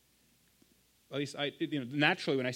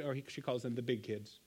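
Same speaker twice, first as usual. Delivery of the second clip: normal talking voice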